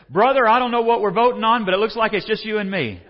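loud, high voice of a man jolted awake; startled churchgoer. Acted. Man just woken in church calling to the preacher, loud and startled. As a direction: act the bewildered sleeper calling out.

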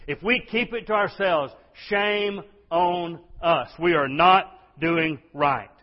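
high, loud, slowed preaching; forceful warning. Real, not acted. Preacher shaming a silent church, forceful and loud. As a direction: hammer it out slowly at full volume.

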